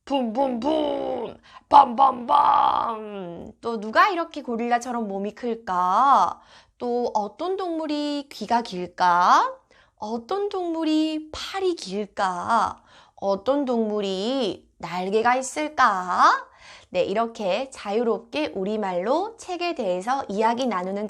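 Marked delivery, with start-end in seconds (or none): 0.0-3.5 slow booming gorilla chant; gorilla sounds. Shown as a demonstration. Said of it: "고릴라 소리 한번 내볼까". 3.6-16.5 put-on reading to a toddler. Shown as a demonstration. Asking a child about animals.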